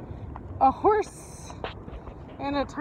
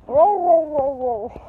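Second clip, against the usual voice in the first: sudden loud whoa, then settling; startled whoa; 6 dB louder, slower than usual. Real, not acted. Owner startled as the dog pulls, loud whoa. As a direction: catch yourself as the dog lurches.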